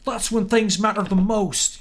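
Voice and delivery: put-on high, loud, slow country accent; hammed-up English country accent, drawn out; Sean Astin in Lord of the Rings. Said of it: "that kind of country accent".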